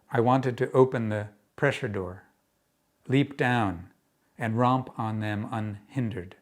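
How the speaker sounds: projected, measured verse delivery; deliberate, emphatic poem recital; poetry reading. Acted. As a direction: tell the poem out to a room, pausing between phrases. Reads own poem aloud.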